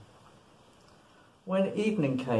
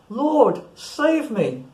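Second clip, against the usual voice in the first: urgent high cry for help; desperate plea, raised voice; 9 semitones higher, 6 dB louder, much slower than usual. A narrator's reading. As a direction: call out louder and higher, as if starting to sink. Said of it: "cried out".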